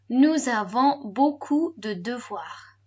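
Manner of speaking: slow full "nous avons beaucoup de devoirs"; all together. Shown as a demonstration. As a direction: join the chunks into one measured model phrase, nous avons beaucoup de devoirs. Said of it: "And all together".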